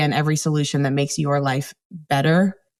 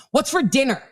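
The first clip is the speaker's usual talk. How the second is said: a pitched-up, curt demand for food; snippy kid tone; 7 semitones higher than usual. Retold or imitated. Teen walking in home, demanding dinner in a snippy tone. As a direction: toss it off like a grumpy teen. Kid's rude question acted out.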